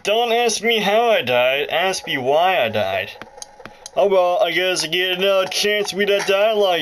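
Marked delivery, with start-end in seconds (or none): none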